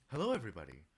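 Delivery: lightly voiced game-show-host welcome; Matthew Mercer voice. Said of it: "Matthew Mercer impression".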